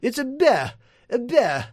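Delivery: high-pitched mock-academic voice; hammed-up high professor; phonetics professor. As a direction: parody a scholar showing off "beh" with the R dropped. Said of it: "all of the phonetics professors with their P H Ds".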